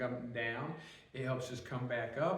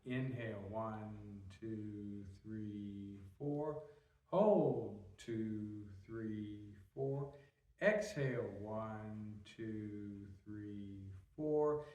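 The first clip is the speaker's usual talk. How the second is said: slow, low, soft counting with clearer cues; guided breathing exercise; 5 semitones lower, 4 dB quieter, much slower than usual. Shown as a demonstration. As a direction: pace their breathing with drawn-out, hushed numbers.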